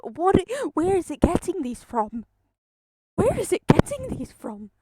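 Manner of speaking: loud, high, baffled outcry; amused disbelief. Real, not acted. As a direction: cry out in hilarious bewilderment. Baffled by absurd garbled captions.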